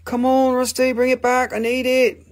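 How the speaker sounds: high, pleading mock customer; eager buyer voice. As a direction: beg for the crystal in a high whine. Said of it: "somebody will be like".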